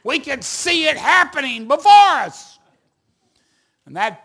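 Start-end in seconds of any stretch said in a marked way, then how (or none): 0.0-2.6 high, loud storyteller's build; loud, raised-pitch suspense; dramatic alarm. Retold or imitated. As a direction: cry out as disaster looms. Dramatic climax of baseball tale.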